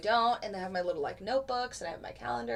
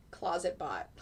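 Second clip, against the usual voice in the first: normal talking voice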